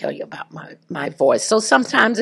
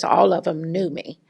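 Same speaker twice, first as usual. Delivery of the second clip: normal talking voice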